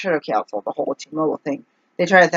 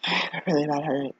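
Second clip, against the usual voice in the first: softer, weary "I have a really bad headache"; tired sighing complaint; 8 dB quieter, faster than usual. Real, not acted. Telling listeners about her headache, weary and sighing. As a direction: sigh it out, worn down by pain.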